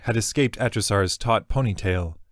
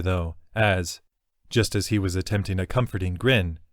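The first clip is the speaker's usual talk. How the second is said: ordinary delivery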